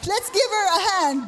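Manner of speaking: loud, high call for applause; rally the room. Real, not acted. Calling for applause.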